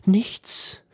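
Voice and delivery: high, thin-voiced sobbed word; mild acted sob. A narrator's reading. Penitent answering his confessor, lightly sobbing. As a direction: let it escape as a small, high sob. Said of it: "schluchzte er".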